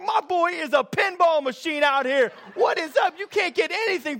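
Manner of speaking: high, shouted mock boasting; hammed-up sideline junk talk; trash talk. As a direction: taunt the whole group as a bragging sports dad. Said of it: "I'm talking junk to the whole group".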